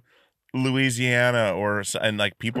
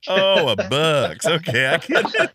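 amused realisation; 5 semitones higher, 5 dB louder than usual. Retold or imitated. Retelling his own realisation.

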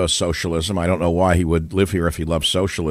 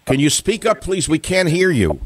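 to someone hard of hearing; 8 semitones higher than usual. Real, not acted. Caller too quiet to hear.

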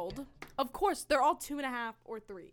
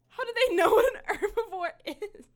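louder and higher, laughing through it; laughing disbelief; 8 semitones higher, 7 dB louder than usual. Real, not acted. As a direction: break into a real laugh mid-question. Amused by the toddler's word.

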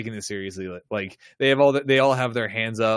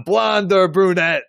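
slow, high and loud insinuating tease; drawn-out nudge-nudge question; leering cop; 7 semitones higher, 6 dB louder, slower than usual. Retold or imitated. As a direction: lean on it like a sleazy detective. Sleazy cop teasing Picard.

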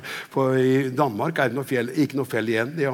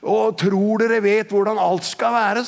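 slow, higher-pitched scolding; retold cross rebuke; 6 semitones higher, 4 dB louder, slower than usual. Preacher imitating the Spirit's scolding of the group, cross raised voice. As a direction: challenge them crossly, imitating a stern rebuke. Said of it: "han var liksom bøs".

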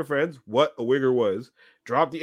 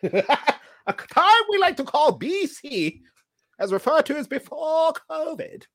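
mock storyteller; 11 semitones higher, slower than usual. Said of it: "mock grand intro".

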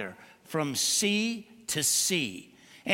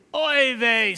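put-on louder, dismayed old father; 3 semitones higher, 7 dB louder than usual. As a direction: groan it like a fretting dad. Father groans in the joke.

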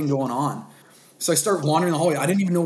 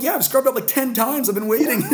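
excited; 10 semitones higher, 3 dB louder than usual. Retold or imitated. Exasperated dad retelling to nurses.

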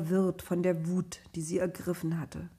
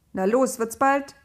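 impatient threat; 5 semitones higher, 6 dB louder than usual. Acted. Naphtali threatens Josef.

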